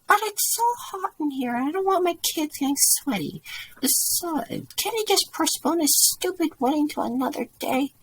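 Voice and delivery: very high-pitched complaining voice; entitled woman. A narrator's reading. Entitled mom argues to keep the hall, high-pitched complaint. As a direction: protest in a high voice, all self-importance. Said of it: "She has the audacity to say".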